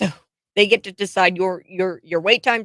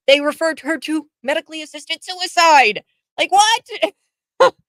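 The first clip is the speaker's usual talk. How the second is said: outraged disbelief; 8 semitones higher, 5 dB louder than usual. Real, not acted.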